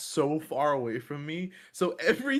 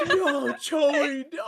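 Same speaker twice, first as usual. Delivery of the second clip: high, loud retold wail; hammed-up horrified shout; mock screaming; 10 semitones higher, 4 dB louder, much slower than usual. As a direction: yell in mock horror, close to laughing. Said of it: "I was already screaming".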